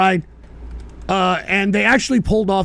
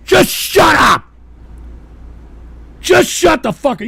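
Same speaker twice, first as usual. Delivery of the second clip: furious shout; 8 semitones higher, 5 dB louder than usual. Real, not acted. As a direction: yell it high and loud. Yelling at trolls.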